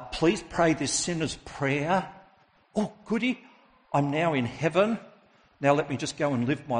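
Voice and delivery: high, gleeful mock convert; mock piety. Mocking an easy convert.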